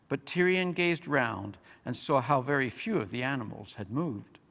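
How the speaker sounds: plain reading voice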